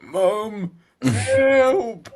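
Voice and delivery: high, loud, drawn-out cry for help; retold scream for mum. Bloke retelling being sick at night, screaming for help. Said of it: "I was screaming".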